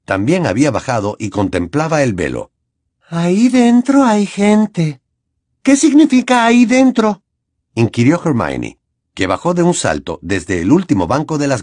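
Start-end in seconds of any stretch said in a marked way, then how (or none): 3.1-5.0 Luna's slow, higher dreamy voice; thin voice. A narrator's reading. Luna telling the others, slow and higher. 5.6-7.2 higher, louder, sharp demand; annoyed Hermione. A narrator's reading. Hermione challenging Luna, higher and louder. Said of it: "mucho más enfadada".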